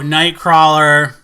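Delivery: slow, stretched title reveal; stretched-out, punchy reveal; drawn-out announcement. Real, not acted. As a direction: announce it loud, drawing out the vowels. Announcing the next movie.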